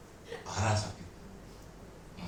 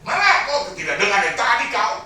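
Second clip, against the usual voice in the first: higher, loud scolding shout; shouting at wife; 8 semitones higher, 11 dB louder than usual. Retold or imitated. Husband yells at wife for not hearing him, loud. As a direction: scold her at full volume.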